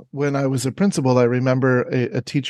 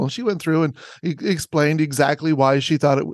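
ordinary delivery

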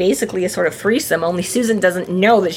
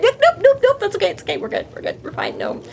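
panicked then hushed; 11 semitones higher, faster than usual. Acted. Bob refusing to go home, panicked then hushed. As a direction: yelp nope, then shrink to a whisper.

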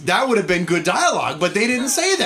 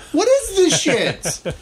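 incredulous; 9 semitones higher, much slower than usual. Real, not acted. Ranting about the bad dialogue.

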